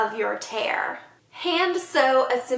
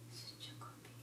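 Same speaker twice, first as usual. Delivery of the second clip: talking to a dog; 31 dB quieter, much faster than usual. Real, not acted. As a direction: whisper fondly to the pet. Cooing softly at the pet.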